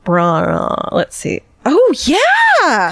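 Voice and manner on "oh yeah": excited. Real, not acted. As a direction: react with real delight. Reacting to a photo.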